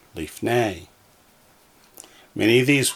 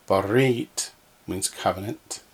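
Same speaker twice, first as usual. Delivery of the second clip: normal talking voice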